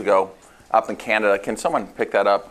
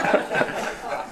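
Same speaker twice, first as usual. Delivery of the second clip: breathy laugh; 5 dB quieter than usual. Real, not acted. Laughs at own joke.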